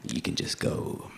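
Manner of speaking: low, slow whispered brush-off; dismissive whisper. Retold or imitated. Official sending him off, low and whispered. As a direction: murmur it as if closing the matter.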